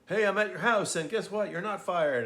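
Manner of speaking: sing-song gleeful announcement at the door; over-the-top cheerful Scrooge surprising Bob's family; mock cheery Scrooge. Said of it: "That has way more impact than".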